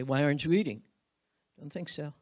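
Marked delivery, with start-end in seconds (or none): 0.0-0.8 much louder and lower, harsh; loud irritated why aren't you eating; demanding question. Shown as a demonstration. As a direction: bark it like an accusation. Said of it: "Or did you say".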